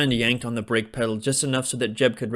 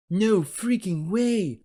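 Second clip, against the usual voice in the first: shocked; 9 semitones higher, slower than usual. A narrator's reading. Tommy shocked.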